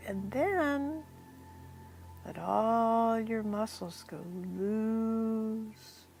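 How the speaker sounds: softly guiding breath by breath toward sleepiness; guided hypnosis voice. Acted.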